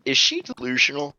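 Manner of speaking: softly muttered aside; hushed question. Acted. Snart and Chirp whispering about the girl, hushed. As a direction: drop the voice to ask.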